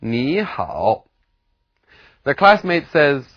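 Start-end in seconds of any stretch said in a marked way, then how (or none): 0.0-1.1 "Ni hao" with "Ni" rising, "hao" low; second tone then third. Shown as a demonstration. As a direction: rise on "Ni", dip on "hao". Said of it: "changes into second tone".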